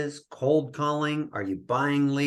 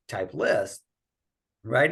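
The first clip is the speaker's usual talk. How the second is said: ordinary talk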